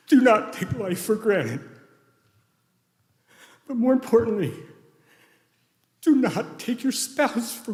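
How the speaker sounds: high, loud, strained with tears; tearful plea, pausing between phrases; real choked-up grief. Real, not acted. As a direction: push each phrase out slowly against tears. Choked up closing a eulogy.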